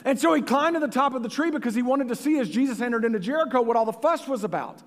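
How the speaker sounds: put-on playful British storytelling accent. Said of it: "do it in a British accent".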